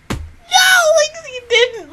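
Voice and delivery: high, loud disbelieving cry; shocked shout. Retold or imitated. Reenacting reaction to Shigure.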